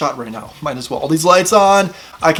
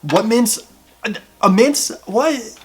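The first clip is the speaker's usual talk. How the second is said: surprised; 8 semitones higher, slower than usual. Real, not acted. Surprised at mint label.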